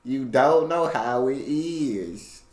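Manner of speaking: slow, drawn-out country accent. Shown as a demonstration. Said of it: "I just did the country accent".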